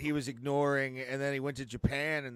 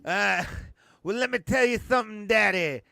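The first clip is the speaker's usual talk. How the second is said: wrestling promo; 7 semitones higher, 7 dB louder than usual. Pretend. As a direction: cut a cocky wrestling promo. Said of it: "You guys should do a wrestling promo video".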